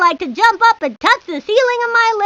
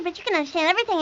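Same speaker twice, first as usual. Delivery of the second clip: fast rattled-off words; a chatterbox kid speeding along; talking really fast; 6 dB quieter, faster than usual. Acted. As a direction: rush the words out in one breath. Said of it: "I talk really fast and stuff".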